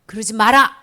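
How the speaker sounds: loud firm command; firm rebuke. Acted. Jesus loudly rebuking the disciples who push children. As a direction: stop them with one loud word.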